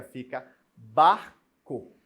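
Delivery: slow "bar... co" with a clear pause; syllable split. Shown as a demonstration. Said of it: "Quando eu faço a separação silábica".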